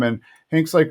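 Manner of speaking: ordinary delivery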